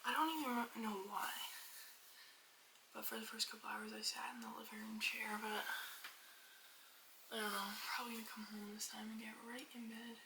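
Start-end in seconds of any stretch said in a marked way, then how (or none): 2.9-5.4 soft unvoiced whisper. Real, not acted. 7.9-10.2 soft unvoiced whisper. Real, not acted.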